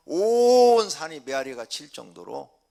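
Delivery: loud, drawn-out cry on 온; echoing loud emphasis. Shown as a demonstration. Preacher showing how prayer rang over the mountain, loud and drawn out. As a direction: stretch 온 big enough to fill a mountain.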